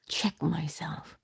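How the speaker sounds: slow, soft whisper; slow whispered self-check. Real, not acted. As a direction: let the words fall into a whisper. Inner reckoning, hushed.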